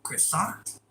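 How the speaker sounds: ordinary talk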